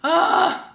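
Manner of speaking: loud, high "ugh" of frustration; exasperated groan. Retold or imitated. Exasperated at address request.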